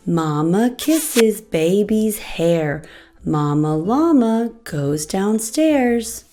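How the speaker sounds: ordinary delivery, nothing marked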